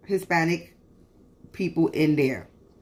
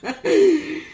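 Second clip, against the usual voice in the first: burst of laughter; 9 semitones higher, 4 dB louder than usual. Real, not acted. Laughs at own threat.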